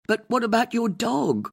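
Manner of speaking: high, plaintive worried man. A narrator's reading. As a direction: ask it pleading and worried. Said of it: "Trevor asked plaintively".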